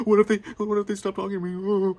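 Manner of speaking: high, whiny, worried voice; mock anxiety. Imitating a friend worried about being shunned, high whine. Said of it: "bad stress is being like".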